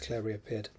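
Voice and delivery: ordinary delivery, nothing marked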